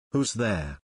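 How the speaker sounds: drowsy, just woken up; a lightly voiced half-asleep man; sleepy voice. A narrator's reading. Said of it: "a sleepy voice sounded".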